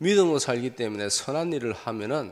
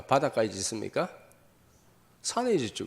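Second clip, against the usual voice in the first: ordinary talk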